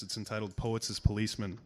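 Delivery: normal talking voice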